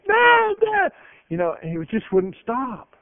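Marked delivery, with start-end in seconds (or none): 0.1-0.9 put-on high, loud child calling Dad over and over; a little boy yelling for his dad. Little boy interrupting his father on the phone, shrill calling. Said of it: "and he's going".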